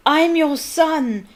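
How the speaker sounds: slow, high, loud anguished scream; a son screaming at his father; drawn-out loud cry. Acted. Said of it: "he screamed up at Crouch".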